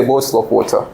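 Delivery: proper Queen's English, T sounded in "water"; outside-home British accent. Shown as a demonstration. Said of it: "when they are outside at the home".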